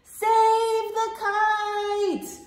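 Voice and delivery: high, slow, drawn-out plea; yelled. Acted. Mole and Bear yelling to save their kite. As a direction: cry out to save it. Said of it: "yelled Mole and Bear".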